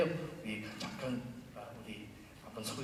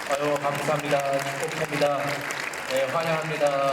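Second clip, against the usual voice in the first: excited welcome; 3 semitones higher, 9 dB louder than usual. Real, not acted. Welcoming newcomers warmly.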